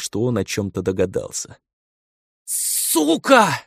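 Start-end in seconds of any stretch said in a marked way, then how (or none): 2.4-3.6 high, frenzied scream; mad scream. A narrator's reading. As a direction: shriek the curse in madness. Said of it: "Зашёлся тёмный эльф в безумном крике". (безумный крик)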